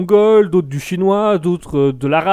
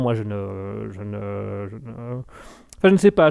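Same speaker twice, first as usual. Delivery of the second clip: ordinary talk, nothing marked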